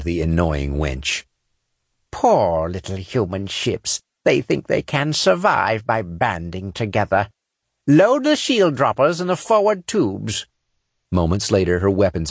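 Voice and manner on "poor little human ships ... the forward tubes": gloat, then bark the command; gloating alien commander. A narrator's reading. Indrat gloats, then orders.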